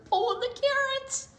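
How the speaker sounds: high, gleeful discovery; spring the surprise on the kids; excited reveal. A narrator's reading. Reader reveals Pig is the thief, high and gleeful.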